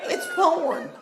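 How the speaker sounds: laughing the punchline out high. Real, not acted. Comic to audience, laughing through the punchline.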